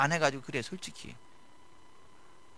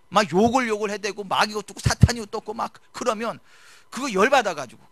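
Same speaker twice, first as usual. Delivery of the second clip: raised, pressing sermon voice; fervent preaching; 6 semitones higher, 6 dB louder than usual. Acted. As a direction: preach it hard at the congregation. Pastor preaching on drunk husbands.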